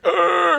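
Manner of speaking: high, drawn-out mock scream; imitated pained cry. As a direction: act out the victim's agonized yell. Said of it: "he's out there crying".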